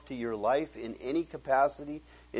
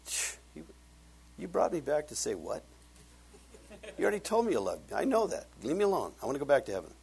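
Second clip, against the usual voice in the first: lightly voiced, higher annoyed dad; a hint of the dad's voice; 5 semitones higher, faster than usual. Dad in heaven mildly scolding his son, lightly voiced. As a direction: play the dad, mildly put out at being called back.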